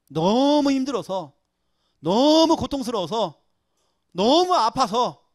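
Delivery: loud, drawn-out stress on each 너무; anguished emphasis. Acted. Preacher voicing David's real feelings, anguished and emphatic. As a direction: stretch 너무 long and hit it hard. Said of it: "감정을 표현한 겁니다".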